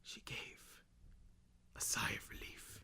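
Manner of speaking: whispered, hushed narration; dramatic whisper. Acted. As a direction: breathe it out softly. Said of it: "dramatic readings from this book".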